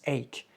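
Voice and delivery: ACHE said as "ake", with a K; pronunciation demo. Shown as a demonstration. Teacher shows how ache is pronounced, demonstrating. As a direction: say ACHE with a hard K ending. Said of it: "mais se prononce".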